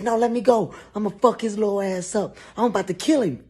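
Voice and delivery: held back, raging; struggling rage. Retold or imitated. Boss restrained, struggling.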